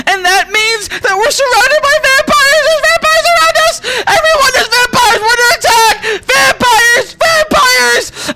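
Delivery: very high, loud hammed-up alarm; panicked yelling. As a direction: shriek a frantic warning to the whole camp. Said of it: "she yells, she says".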